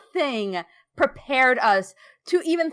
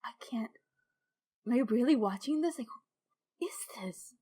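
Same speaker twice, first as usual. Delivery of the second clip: breathless, soft, whispered shock; stunned whisper; 7 dB quieter, faster than usual. Retold or imitated. Player watching son's death reveal, stunned whisper. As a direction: barely voice it, hands to the face. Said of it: "hands to my face".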